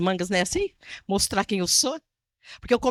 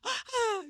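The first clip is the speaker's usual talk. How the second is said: mock gasp of fright; 12 semitones higher, 7 dB quieter than usual. Elijah hears Jezabel threat.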